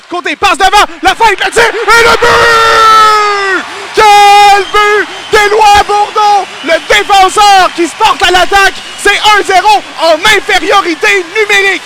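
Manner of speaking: loud, high, stretched-out goal shout; excited sports commentary. Real, not acted. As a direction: scream the goal call to the crowd. Goal call in play-by-play. (commentaire de but survolté)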